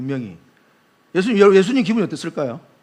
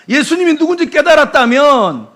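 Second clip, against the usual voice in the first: ordinary talk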